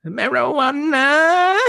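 drawn-out breathy mock moan of Marijuana; mock orgasmic. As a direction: moan the word Marijuana like pleasure, laughing. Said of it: "It sounds like you're about to have an orgasm".

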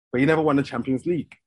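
ordinary delivery

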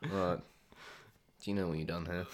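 a hint of Hugh's ratty voice, low and soft; lightly voiced sneering teacher. Hugh walks in and scolds the class, lightly ratty. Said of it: "You know that ratty voice he has?".

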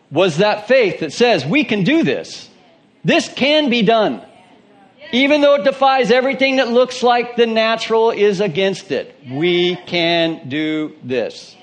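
high, loud, building declaration; fiery preaching. Acted. Preacher rallying the congregation, fervent climax. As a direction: rise to a rousing we can do this.

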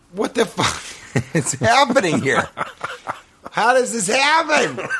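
high, laughing disbelief, slowing at the end; laughing panic. Retold or imitated.

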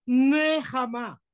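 NEHAMA slow and high, pushed initial n; explosive nun. Shown as a demonstration. Teacher showing explosive nun, stressed N. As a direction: burst out of the N in "Nehama". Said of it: "es explosiva".

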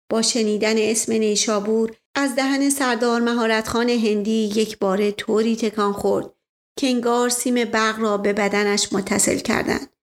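usual speaking voice

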